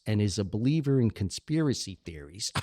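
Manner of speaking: usual speaking voice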